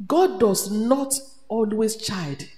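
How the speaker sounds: slow and weighty, driving it home; deliberate slow preaching; slow, emphatic. Acted. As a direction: stretch each word out. Preacher drawing out scripture.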